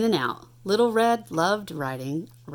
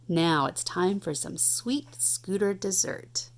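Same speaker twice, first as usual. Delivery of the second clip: ordinary delivery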